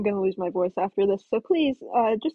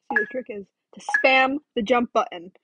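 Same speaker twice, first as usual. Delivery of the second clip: ordinary delivery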